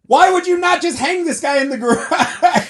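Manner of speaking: high, loud exasperated yell breaking into laughter. Real, not acted. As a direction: yell the question at the top of your voice. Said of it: "pissed 'cause I'm yelling".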